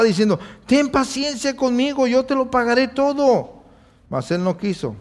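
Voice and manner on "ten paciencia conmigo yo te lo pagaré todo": higher begging plea; begging fellow servant. Acted. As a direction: plead from the ground, voice raised. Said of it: "le rogaba". (consiervo rogando con voz más aguda)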